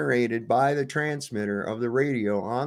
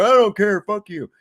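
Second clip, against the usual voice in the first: mock cop yell; 10 semitones higher, 6 dB louder than usual. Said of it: "cop voiced in a rant".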